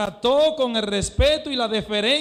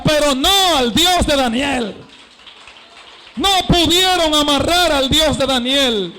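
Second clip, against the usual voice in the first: loud, higher, shouted sermon climax; preaching at full force; 4 semitones higher, 9 dB louder than usual. Acted. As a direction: hammer each line at the congregation. (predicación a todo pulmón, con gritos)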